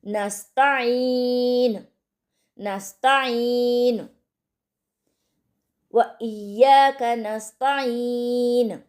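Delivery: नस्ताइन with a clear ain from the throat, slow. Shown as a demonstration. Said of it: "हलक से साफ आइन के मखरज को हम अदा करेंगे".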